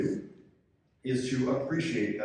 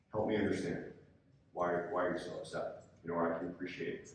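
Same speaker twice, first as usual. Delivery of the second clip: soft, calm, reassuring, softer than the lines round it; nice calm tone; 5 semitones lower, 7 dB quieter than usual. Shown as a demonstration. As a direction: talk an angry coach down gently. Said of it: "this nice calm tone of voice".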